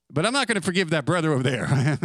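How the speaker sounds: a petty believer's quick aside; hypocritical aside. Retold or imitated. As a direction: rush it like a grudge slipping out. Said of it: "hypocrite's aside".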